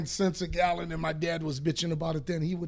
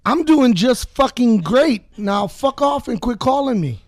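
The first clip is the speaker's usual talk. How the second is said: angry shout at telemarketer; 4 semitones higher, 12 dB louder than usual. Acted. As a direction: blast the caller off the line. Yelling at a telemarketer on air.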